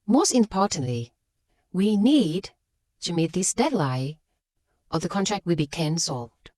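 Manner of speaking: "Most importantly" set off by a pause, then "need" stressed; pause after introductory phrase. Shown as a demonstration. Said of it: "add an introductory phrase".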